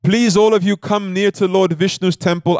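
mock shout from a temple roof; calling out. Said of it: "he screams to everybody".

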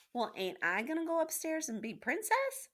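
put-on high-pitched servant girl protesting; Meg's indignant question. A narrator's reading. Meg protests to the rat.

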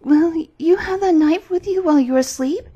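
high, loud, alarmed Gerda; frightened question. A narrator's reading. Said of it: "looking at it in great fright".

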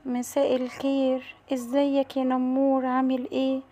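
slow, soft greeting; timid animal pupil. Acted. Pupil greets the tiger before admitting fear, timid. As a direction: greet shyly, drawing words out.

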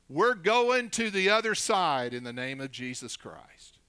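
loud, emphatic preacher's declaration; declaring by faith. Real, not acted. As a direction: proclaim it boldly to the congregation. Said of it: "we're declaring by faith".

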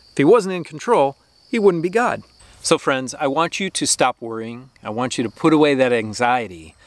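ordinary talk, nothing marked

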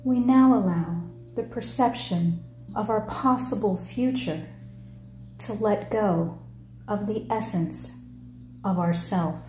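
measured meditation-leader voice, falling phrase ends; guided meditation. Acted. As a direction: lead them through it in short phrases with long pauses.

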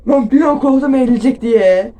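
loud, high relieved outburst; scared relief. Real, not acted. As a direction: blurt out the fright.

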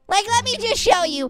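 normal talking voice

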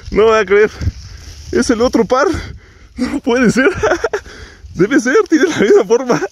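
can't believe the luck, voice up high; real excitement at finding the pair; excited disbelief. Real, not acted. Finds the matching earring pair.

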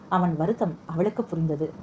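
ordinary delivery, nothing marked

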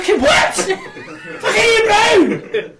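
high, loud laughing yell of disbelief; outraged shout. Retold or imitated. Wife learning she missed [name], outraged shout. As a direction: shriek it like she can't believe she missed him. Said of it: "She was like".

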